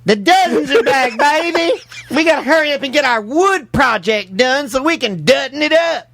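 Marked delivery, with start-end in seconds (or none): 0.1-3.7 excited hype. Acted. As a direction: sell it like a promo. Hyping show's return, repeated.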